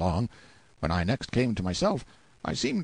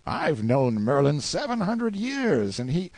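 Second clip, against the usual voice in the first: slow, higher, drawn-out pronouncement; grand boast; 7 semitones higher, slower than usual. A narrator's reading. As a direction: lay out an outrageous claim with slow weight. Boasts to awe the boy.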